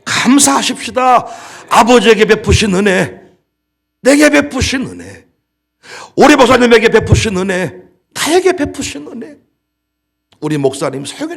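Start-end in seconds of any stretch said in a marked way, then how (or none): 0.1-1.3 slow, ringing "감사하십시다"; stretched proclaiming "감사하십시다"; loud exhortation. Shown as a demonstration. 1.7-9.4 shouted sermon climax; fiery preaching. Acted. Preaching at full force.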